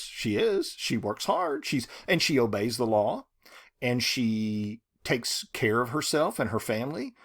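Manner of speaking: usual speaking voice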